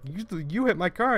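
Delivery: a hint of an accusing phone voice; imitated angry caller. Retelling the stranger's accusation, lightly imitating the angry caller. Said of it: "trying to reenact the gentleman's voice".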